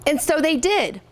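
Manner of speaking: storybook reading voice, lively and clear; picture book read-aloud; reading to children. Acted. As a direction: read it out to a class of third graders.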